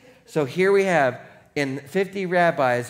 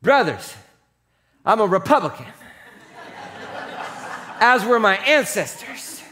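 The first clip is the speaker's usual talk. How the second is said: declaimed in punched phrases, building louder; mock oratorical shout; 6 semitones higher, 5 dB louder than usual. Paul rallying brothers on the council, building declamation. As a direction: proclaim it to a divided council. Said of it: "So he shouted".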